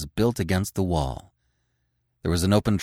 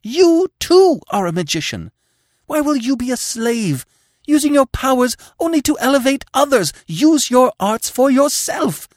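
high, loud, furious urging; 7 dB louder than usual. Acted. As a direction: shake him with fury. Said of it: "the fury of her emotion".

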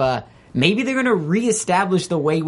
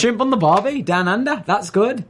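higher, hammed-up Australian accent; Australian accent with an Irish slip; mock Australian accent; 7 semitones higher than usual. Showing off for the cohosts, a hammed-up Australian accent. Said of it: "Irish guy trying to sound Australian".

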